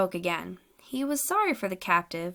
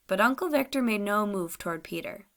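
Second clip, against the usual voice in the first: ordinary delivery, nothing marked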